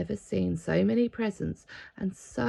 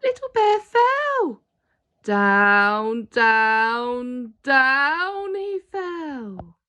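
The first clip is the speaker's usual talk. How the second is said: high, slow storybook fall, drawn out; dramatic falling; 5 semitones higher, 9 dB louder, much slower than usual. A narrator's reading. Reading to a child, stretching the bear's fall slowly. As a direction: let each down drop like a long tumble.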